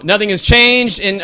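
normal talking voice

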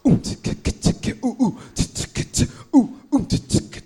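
beatboxed oohs and tsks; mouthed R&B beat. Retold or imitated. Comic imitating nineties R&B songs, beatboxed. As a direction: tick out a sparse mouthed drum groove. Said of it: "Every song was like".

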